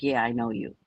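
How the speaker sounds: low, dry, unimpressed yeah. Retold or imitated. Cousin coldly acknowledging him at the table, dry and low. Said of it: "with these dry eyes".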